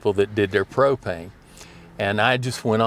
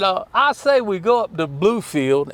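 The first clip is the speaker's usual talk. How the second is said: put-on high-pitched Danville, Virginia accent; 9 semitones higher than usual. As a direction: imitate an old boss's Danville, Virginia accent. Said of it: "Dewey had that beautiful Danville, Virginia accent".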